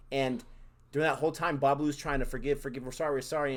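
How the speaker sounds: ordinary delivery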